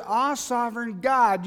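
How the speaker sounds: imitated cry of recognition. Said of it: "he cries out in a verse".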